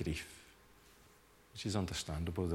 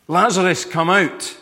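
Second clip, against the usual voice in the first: loud commanding call, pitched high; shout; 9 semitones higher, 16 dB louder than usual. Retold or imitated. Jesus calling Lazarus from the tomb, a loud high shout. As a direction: belt the name out like a command. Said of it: "he shouts".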